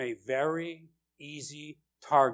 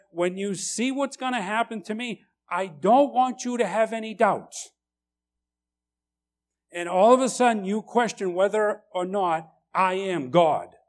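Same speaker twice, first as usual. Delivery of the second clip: higher, earnest reassurance as Jesus; Jesus reassures frightened disciples, higher and earnest; voicing Jesus; 6 semitones higher, 6 dB louder than usual. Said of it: "Jesus was like".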